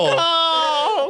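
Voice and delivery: high crying shout, half laughing; laughing outcry. Real, not acted. Friend cracking up at the story, crying out. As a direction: yelp it out through laughter.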